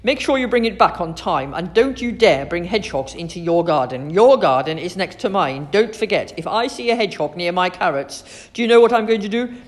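loud, emphatic scolding; stern warning. A narrator's reading. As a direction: lay down the law to a child. Grumpy librarian warns the girl.

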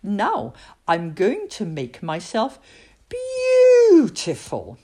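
slow build to a soaring, drawn-out final word. Acted. Julie tells herself she will make herself beautiful, soaring. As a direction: savour the last word like a wish.

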